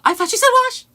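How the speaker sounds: high laughing shriek; excited shriek. Real, not acted. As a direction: squeal it through laughter. Cracking up over the joke.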